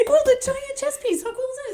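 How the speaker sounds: very high, loud excited squeal. Real, not acted. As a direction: gush over the giant chess piece. Excited at chess piece.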